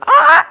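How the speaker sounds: high, loud sharp owl bark; sharp yelping bark. Retold or imitated. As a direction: bark like a dog-sounding owl. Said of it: "bark that sounds like a dog".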